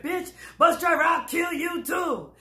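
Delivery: high mock shout at the driver; retold threat. Woman threatening the bus driver, high mock shout.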